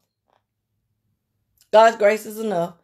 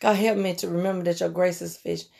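hushed, softer pleading prayer; 3 semitones lower, 7 dB quieter than usual. Shown as a demonstration. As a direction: pray it under your breath. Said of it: "God, just say".

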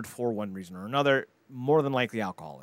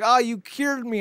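high breathless mock gratitude; hammed-up relieved heroine; mock grateful girl; 12 semitones higher, 3 dB louder, slower than usual. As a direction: squeak out thanks in parody. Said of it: "And she's like".

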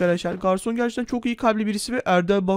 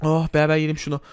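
ordinary talk, nothing marked

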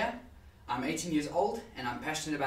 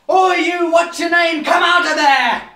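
much louder, higher full shout; rough summoning shout; 14 dB louder, slower than usual. Acted. Banging on the lid to summon someone, shouting. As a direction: yell it at someone shut away.

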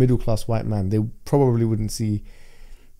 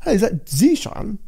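high, plummy Queen's English on the phone; posh Queen's English; 10 semitones higher than usual. Shown as a demonstration. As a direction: greet him like a royal salesman. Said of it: "Queen's English".

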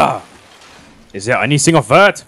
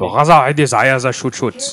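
put-on German accent. Mock German persona in game.

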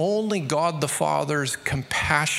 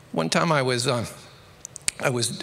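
usual speaking voice

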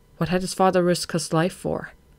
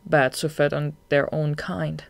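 usual speaking voice

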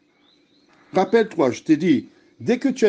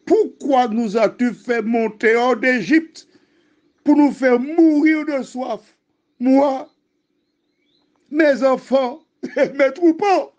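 high-pitched, aggrieved protest; acted outcry, pitched way up; indignant complaint; 10 semitones higher than usual. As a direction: voice a thirsty people reproaching their leader. Thirsty people accuse Moses, read aloud.

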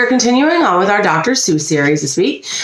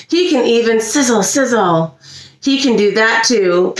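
ordinary delivery

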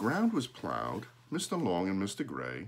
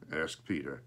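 ordinary delivery, nothing marked

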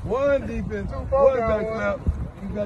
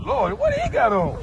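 high, slow amazed "Lord"; astonished at what he sees; surprised exclamation. Real, not acted. Coach surprised at player's gear.

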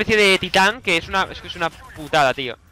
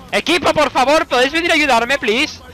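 high, loud pleading call; loud plea; 6 semitones higher, 4 dB louder than usual. Real, not acted. Player pleading with his AI team for help, loud call. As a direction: beg the team to come help. Begging teammates for help loudly.

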